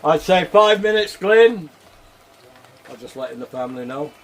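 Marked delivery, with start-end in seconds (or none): 0.0-1.7 calling out. Real, not acted. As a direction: call to Glyn in the next room, higher and louder. Calling out to Glyn.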